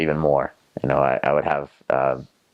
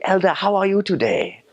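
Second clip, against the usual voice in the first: high, sing-out Zimbabwean accent greeting; imitated Zimbabwean English, pitched way up. Shown as a demonstration. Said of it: "let's see if I can still do this".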